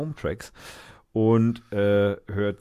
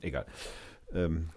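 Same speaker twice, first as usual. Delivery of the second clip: ordinary talk, nothing marked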